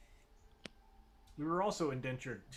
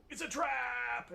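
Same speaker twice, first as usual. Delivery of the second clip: dramatic movie line; 11 semitones higher, much slower than usual. Retold or imitated.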